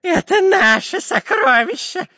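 high, slow, tearful shriek; Dyrka screaming. A narrator's reading. Spy clutches the treasure chest, screaming. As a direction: wail greedily, stretching every word. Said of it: "кричал длинноносый шпион Дырка".

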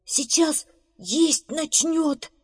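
high, drawn-out, fearful alarm; horrified. Acted. Beaver fears bear will eat hare, horrified. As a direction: stretch each word high in dread. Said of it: "в ужасе прошептал бобр".

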